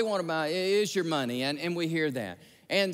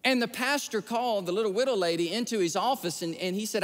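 usual speaking voice

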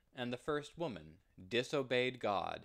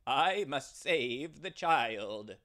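louder, slow and determined; ram's firm resolve; 3 semitones higher, 7 dB louder, slower than usual. Acted. The ram answers the tempter, loud, slow and determined. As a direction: state it firmly, each word weighed. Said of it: "the ram replied".

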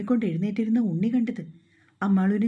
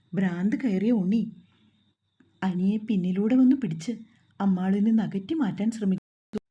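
usual speaking voice

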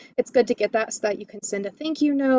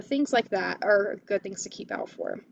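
normal talking voice

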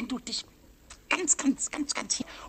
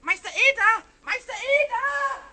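excited calling; 9 semitones higher than usual. Acted. Pumuckl rushes in excited.

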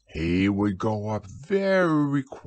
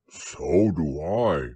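very slow, lower bear voice; contented Pooh; 5 semitones lower, much slower than usual. A narrator's reading. Pooh answers Christopher Robin's affection, slow and low. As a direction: draw it out like a fond, simple bear. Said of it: "said Pooh".

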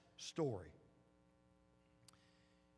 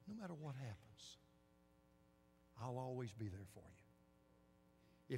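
much softer, slow and lower; hushed promise; 4 semitones lower, 14 dB quieter, slower than usual. Acted. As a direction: breathe the promise out, slowly. Promise of the Holy Spirit.

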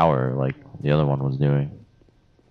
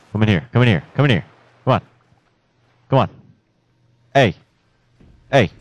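urgent luring; 6 semitones higher, 6 dB louder, faster than usual. Real, not acted. Luring an enemy in a fight.

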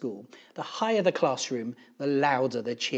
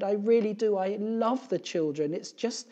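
high, emotional protest trailing off; high-pitched, upset and breaking off; tearful teacher; 9 semitones higher, 5 dB louder than usual. A narrator's reading. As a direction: plead like a teacher close to tears. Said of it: "she stopped and wiped her eyes".

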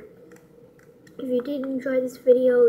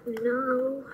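ordinary delivery

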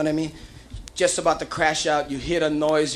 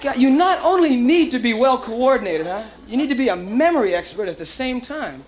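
normal talking voice

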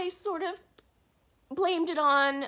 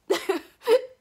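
laugh-cry; 5 semitones higher, 9 dB louder than usual. Real, not acted. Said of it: "I really didn't think I was gonna cry this much".

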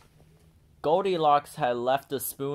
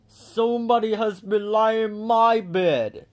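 much louder, higher booming bear; great big bear voice; 10 semitones higher, 8 dB louder than usual. A narrator's reading. Papa bear finds his chair sat in, booming. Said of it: "said the great big bear in his great big voice".

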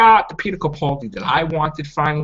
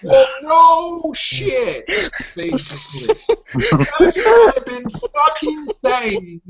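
exasperated rant; 10 semitones higher, much slower than usual. Real, not acted. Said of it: "ranting about the critics".

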